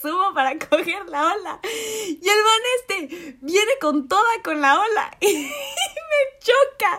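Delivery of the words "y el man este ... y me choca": laughing while telling. Real, not acted.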